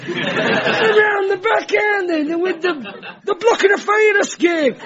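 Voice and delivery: high-pitched comic shop-worker rant; hammed-up indignant shouting; mock exasperation. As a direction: berate a clueless customer, drawn out and high. Acted rant at customer, resumed.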